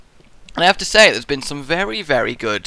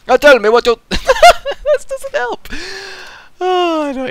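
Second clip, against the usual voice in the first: high, breaking into laughter mid-line; can't hold back laughing at himself; 7 dB louder than usual. Real, not acted. Cracking up at own accent.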